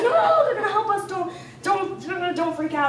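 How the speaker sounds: calm him down through a laugh; high laughing reassurance. Retold or imitated. Calming the panicking man.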